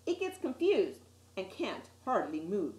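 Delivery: ordinary delivery, nothing marked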